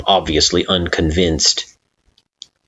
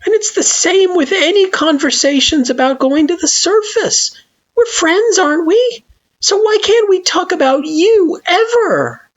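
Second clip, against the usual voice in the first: pleading friend; 4 dB louder than usual. Acted. Frisk pressing.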